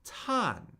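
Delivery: "tan" starting high and falling steadily; fourth-tone "tan", a clean downward fall. Shown as a demonstration. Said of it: "and then it steadily falls".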